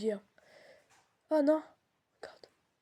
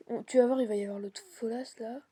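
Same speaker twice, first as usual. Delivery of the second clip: ordinary talk, nothing marked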